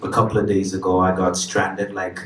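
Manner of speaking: ordinary talk, nothing marked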